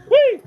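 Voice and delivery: high, loud squeal; playful whee. Pretend. Comedian clearing out of the camera's way, playful squeal. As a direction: let out a short high whee. Clowning while leaving the stage.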